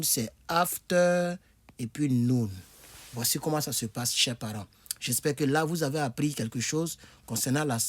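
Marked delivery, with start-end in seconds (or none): 0.0-2.5 "after" drawn out slowly, then "noon"; word split slowly. Shown as a demonstration. Teacher splitting "afternoon" for parents, slow. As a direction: break "after" and "noon" into two slow parts. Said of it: "Le mot afternoon".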